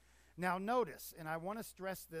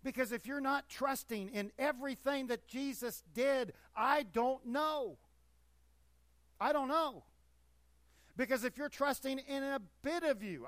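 raised, louder impassioned pleading; 7 semitones higher, 7 dB louder than usual. Real, not acted. Preacher to congregation, impassioned raised voice. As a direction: pitch it up with urgency, half disbelieving.

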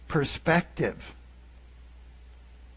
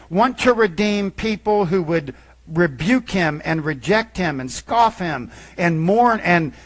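rising full-force sermon voice; preaching; 5 dB louder than usual. Acted. Pastor preaching to his church, building to full force. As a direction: preach it out to the congregation.

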